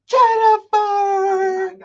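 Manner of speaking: high, long-held name call; drawn-out high hail. Real, not acted. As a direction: stretch the name right out, high and sustained. Calling out for her co-host.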